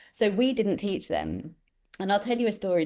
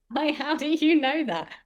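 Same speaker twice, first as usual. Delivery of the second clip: astonished teacher; 8 semitones higher than usual. Retold or imitated. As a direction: play the stunned teacher, pitched up. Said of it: "this teacher was like".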